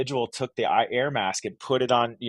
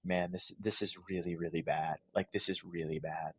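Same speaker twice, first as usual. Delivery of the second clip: hushed inner thought; 5 semitones lower, 12 dB quieter than usual. Retold or imitated. Retold realization after the crash.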